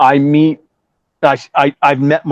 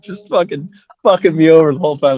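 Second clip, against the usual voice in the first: usual speaking voice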